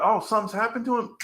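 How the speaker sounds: quick, high, startled realization; imitating a friend who just heard bad news, pitched high and rushed; mock alarm. Talking to a cohost about a homie in trouble, quick startled high voice. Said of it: "You're like".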